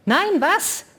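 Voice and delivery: high, loud shout of feigned surprise; a shrill surprised outburst; cry out. A narrator's reading. Nisaratschek cries out in feigned surprise, loud. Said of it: "schrie Nisaratschek auf".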